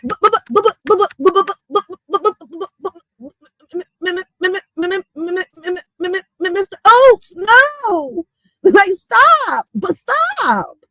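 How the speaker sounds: high, squeaky chipmunk stammer; squeaky cartoon chipmunk pleading; little cute chipmunk. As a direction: sputter the "buts" like a tiny chipmunk. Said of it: "I'ma sound like this little cute chipmunk".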